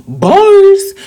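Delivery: high, loud exclamation; playful hype shout. Pretend. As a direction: shout it out, pleased with your own wordplay. Mock hype-up at own wordplay.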